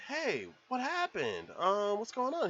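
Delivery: concerned neighbor. Retold or imitated. Producer asking his neighbor Rick, mild high-pitched concern. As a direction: raise the pitch into worried questioning.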